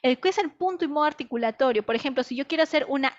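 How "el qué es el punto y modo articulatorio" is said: ordinary talk, nothing marked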